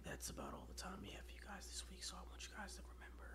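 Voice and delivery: fast breathy creep whisper; creepy whisper. Pretend. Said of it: "the creep voice".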